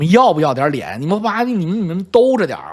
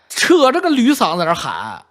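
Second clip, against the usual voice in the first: ordinary delivery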